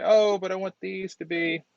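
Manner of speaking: high, sustained pitch for a hypothetical designer; imagined designer. Acted. Designer wants these aligned right, high-pitched mock request. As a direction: voice someone else's request, pitched well up. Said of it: "You could also come in and say".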